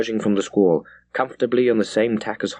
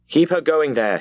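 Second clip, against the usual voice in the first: shouted order; 6 semitones higher than usual. A narrator's reading. Captain calling to the helmsman in a gale, mild shouted order. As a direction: call the order across the deck. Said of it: "he shouted to the wheel".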